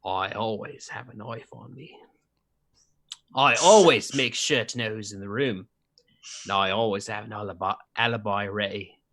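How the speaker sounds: low, soft Cockney sliding into Australian; character's Australian-tinged Cockney; attempted Cockney accent. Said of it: "I'm trying to make it more Cockney".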